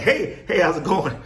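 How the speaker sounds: high, loud, hyped-up hello; imitating himself pouncing on strangers; overeager greeting. Greeting strangers in the parking lot, high and overeager. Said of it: "I'm coming at them with this energy".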